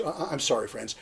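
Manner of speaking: normal talking voice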